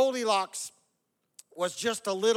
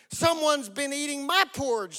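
higher storybook bear voice; Mama Bear; 8 semitones higher, 4 dB louder than usual. A narrator's reading. Mother bear finds her porridge eaten, high voice. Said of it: "said Mama Bear".

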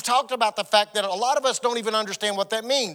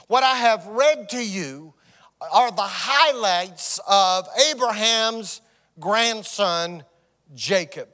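usual speaking voice

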